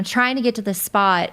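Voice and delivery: normal talking voice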